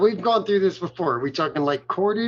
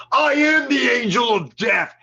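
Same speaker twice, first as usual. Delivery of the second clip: higher, louder, screaming it out; crazed battle cry; 7 semitones higher, 4 dB louder than usual. Retold or imitated. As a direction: roar it like a maniac in the cage. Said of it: "He's like".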